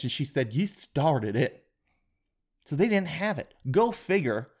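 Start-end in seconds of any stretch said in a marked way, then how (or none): 0.5-1.7 put-on deadpan it in a Southern accent. Clerk answering him back, in a Southern accent. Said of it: "Southern accents".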